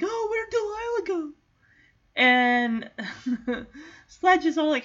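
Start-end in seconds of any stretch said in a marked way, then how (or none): 0.0-1.3 a much higher, whiny voice; mock-pining coonhound; lovesick hound. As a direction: fret like a lovestruck dog who lost his girl.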